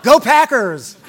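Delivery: loud laughing cheer for the team; a high, loud, laughing team cheer. Pretend. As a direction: yell it like a fan in the stands.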